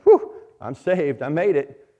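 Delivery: loud, high gasp of relief; relieved escape. Acted. Those escaping the flames to each other, loudly relieved. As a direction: burst out like you barely made it.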